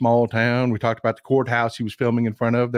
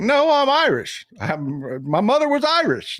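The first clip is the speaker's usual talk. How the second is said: high, insistent mock protest; hammed-up high-pitched insistence on being Irish; indignant protest; 10 semitones higher than usual. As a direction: squeak out the objection like an offended American. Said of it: "And it's like".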